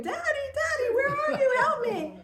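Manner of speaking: high, loud mock scream of a frightened child; boy screaming. Little boy chased by an animal calls his father, mock screaming. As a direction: imitate a little boy crying out for Daddy. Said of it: "the boy is screaming".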